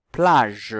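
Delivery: PLAGE loud, ending on a held -ge; teacher's drawn-out PLAGE; sounding out. Shown as a demonstration. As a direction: stretch PLAGE so the j sound rings. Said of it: "est-ce qu'on entend".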